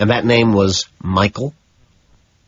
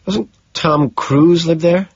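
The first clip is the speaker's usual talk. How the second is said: curious; 10 semitones higher, 3 dB louder, slower than usual. A narrator's reading. Kimball asks, impressed.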